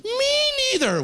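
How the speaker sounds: very high, slow drawn-out squeal; comically over-excited agreement; over-eager confession. Retold or imitated. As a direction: blurt it out, stretching it high.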